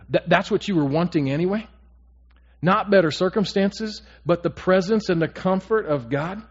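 loud, high, fervent pulpit voice; preaching at full force. Acted. Pastor urging his congregation, fervent preaching. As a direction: drive it home to the congregation.

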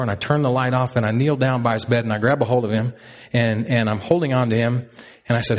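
ordinary talk, nothing marked

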